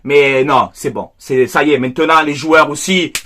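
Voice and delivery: ordinary talk, nothing marked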